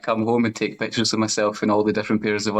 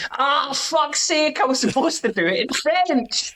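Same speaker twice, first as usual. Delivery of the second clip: much higher, slow mock exasperated outburst; mock frustration; 13 semitones higher, slower than usual. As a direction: ham up the sudden realisation of a blown audition. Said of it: "and you go like".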